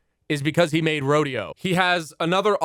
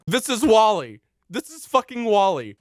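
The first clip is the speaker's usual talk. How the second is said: emphatic exclamation; 6 semitones higher, 4 dB louder than usual. Real, not acted.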